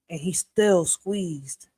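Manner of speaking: slow emphasis. Real, not acted.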